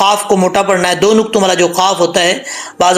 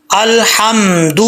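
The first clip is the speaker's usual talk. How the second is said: slow, drawn-out recitation of الحمد; slow chanted Arabic; Quran recitation; much slower than usual. Shown as a demonstration. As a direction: recite it slowly for the class to repeat. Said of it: "آپ نے میرے ساتھ ساتھ پڑھنا ہے".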